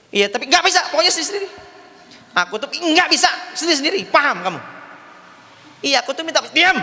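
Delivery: high-pitched acted marital squabble; heated quarrel. Husband and wife quarrelling over separating, heated. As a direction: argue back and forth, voice pushed high.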